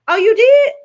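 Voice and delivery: high, loud delighted surprise; surprised. Real, not acted. Streamer, surprised by her friend's message: high, loud delight.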